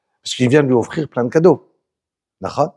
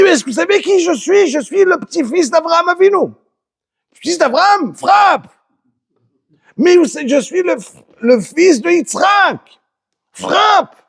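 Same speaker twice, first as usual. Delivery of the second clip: high, hammed-up pleading wail; Esav pleading; 3 dB louder than usual. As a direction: beg the angels in a squeaky voice. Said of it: "Il dit". (Esav supplie les anges, voix aiguë exagérée)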